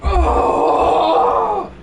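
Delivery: loud, high, drawn-out "oh"; high wailing cry. Real, not acted. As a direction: wail out an oh at the game. Reacting to the game.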